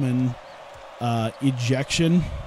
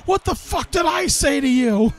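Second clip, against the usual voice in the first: imitated furious scream; 10 semitones higher than usual. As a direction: scream it like a manager losing it completely. Manager screaming at the umpire.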